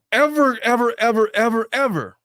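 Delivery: loud, high, hammering each repeated word; emphatic, acted ultimatum; forceful declaration. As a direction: lay down the law as an outraged husband. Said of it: "would he forcefully declare".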